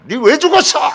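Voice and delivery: high, loud demand to the dead; re-enacting an anguished shout; grieving yell. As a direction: hurl the question out in grief. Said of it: "하면서 막 소리".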